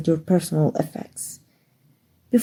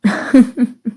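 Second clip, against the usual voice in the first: bright laugh; 4 semitones higher, 3 dB louder than usual. Real, not acted. Laugh after his answer.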